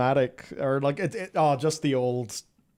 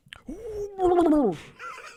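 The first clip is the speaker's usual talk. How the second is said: squealing laugh. Real, not acted. Giddy over mount bonus.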